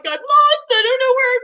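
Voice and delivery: high, loud mock wail; hammed-up helpless whine; retold tearful wail. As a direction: imitate yourself crying and lost. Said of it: "Started crying".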